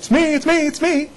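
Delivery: high, excited "it's me"; eager self-claim. Real, not acted. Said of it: "Who is that?".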